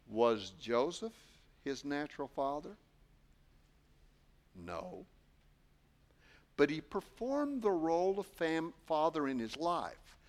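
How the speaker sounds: normal talking voice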